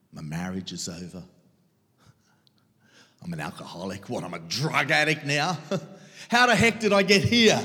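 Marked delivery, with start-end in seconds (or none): 4.1-6.3 stunned disbelief. Retold or imitated. Despair at sudden ruin. 6.3-7.6 a loud, stunned cry of disbelief. Retold or imitated. Same question loud then whispered.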